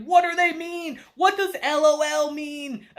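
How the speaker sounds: clueless confused uncle. Retold or imitated. Clueless uncle reading comments.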